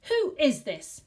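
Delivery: slow, loud, high demand. A narrator's reading. Husband demanding into the receiver, slow and loud. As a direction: bark the question down the phone. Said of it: "he demanded".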